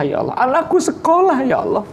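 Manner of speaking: put-on high, whiny begging to God like a child; whining plea. Father begs God for his child's schooling, whining. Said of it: "minta sama merengek".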